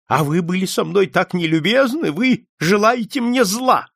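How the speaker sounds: high, accusing voice, slowing at the end; agitated old man. A narrator's reading. Old man accuses detective of ill will, high and agitated. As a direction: accuse him, pitch climbing as you go.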